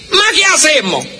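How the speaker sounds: put-on high Italian-accented exclamation; Italian angry. Italian father shrugging off a mess, high exasperated. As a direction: throw up your hands like an angry Italian. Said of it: "Piensen en este gesto".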